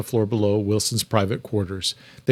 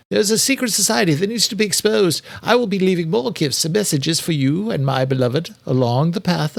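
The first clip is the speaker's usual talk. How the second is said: higher, eerie voice reading a killer's letter; chilling recitation; 8 semitones higher, 3 dB louder than usual. A narrator's reading. Austin recites the Ripper's letter to Wilson, chilling and higher. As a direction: recite it as if the killer were speaking. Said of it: "Austin recited every chilling word".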